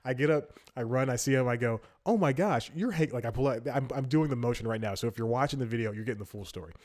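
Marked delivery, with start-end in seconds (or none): 2.1-3.1 high, excited recognition; starstruck gasp. Retold or imitated. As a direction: blurt it out like you just spotted your hero. Recognizing a celebrity on a run.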